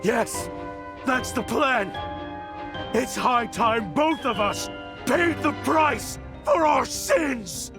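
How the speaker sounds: high, impassioned cry; desperate defiance. Acted. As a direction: declare it wildly, ready to die for it. Vows to die with enemy.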